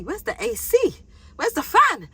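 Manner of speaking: high-pitched mock desperation; exasperated by heat. Sweating newcomer hunting for a fan, acted exasperation. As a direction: demand relief, voice leaping up comically.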